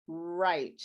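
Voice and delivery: "right" with no W, just a clear R; silent W in right. Shown as a demonstration. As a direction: say "right" starting straight on the R. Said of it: "The W is silent".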